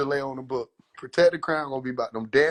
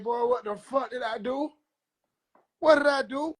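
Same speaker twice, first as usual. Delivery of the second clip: panicked regret; 7 semitones higher than usual. Retold or imitated. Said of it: "them folk, wow, like".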